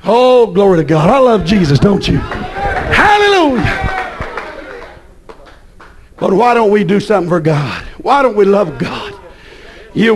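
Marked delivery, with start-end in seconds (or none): 2.2-3.8 high, slow drawn-out hallelujah; sung-out hallelujah cry; ecstatic hallelujah. Real, not acted. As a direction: stretch the praise up high.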